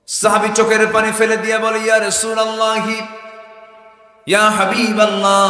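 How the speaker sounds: loud, high chanted preaching; full-force preaching. Acted. Preacher voicing a companion's plea to the Prophet, full-force chant. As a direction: cry it out to the crowd, high and drawn on one note.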